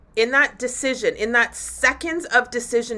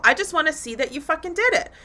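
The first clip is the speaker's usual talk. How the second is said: ordinary talk, nothing marked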